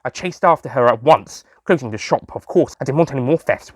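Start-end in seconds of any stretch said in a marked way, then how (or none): none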